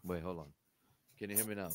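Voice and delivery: distant, faint voice off mic; speaking well away from the microphone; far from mic. Real, not acted. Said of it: "you sound like you're far away".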